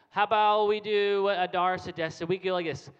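ordinary delivery

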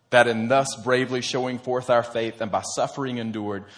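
normal talking voice

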